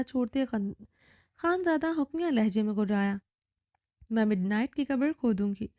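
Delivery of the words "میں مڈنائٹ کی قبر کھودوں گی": plain reading voice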